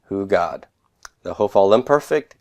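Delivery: usual speaking voice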